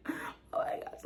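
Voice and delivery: hushed thrilled gasp of words; excited whisper. Retold or imitated. Peeking at the star from the club, excited whisper. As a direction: whisper it, starstruck.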